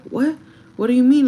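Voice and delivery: acted-out confused comeback; retold bewilderment. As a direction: act out being caught off guard. Acts her startled reply in quarrel.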